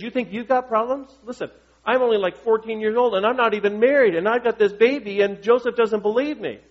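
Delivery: put-on a put-upon fourteen-year-old griping back; whiny teen Mary. Pastor voices whiny teen Mary.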